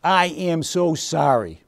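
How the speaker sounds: slow, each word weighed; I am so sorry, said slowly and earnestly; slow sincere apology. Shown as a demonstration. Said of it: "Powerful words".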